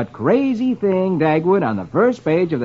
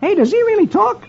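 high-pitched comic-strip husband; eager Dagwood; 3 dB louder than usual. A narrator's reading. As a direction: ask it high and excited. Dagwood asks shopkeeper eagerly.

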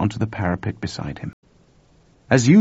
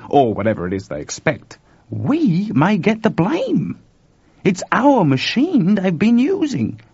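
worried Chuck; 9 semitones higher, 5 dB louder than usual. A narrator's reading.

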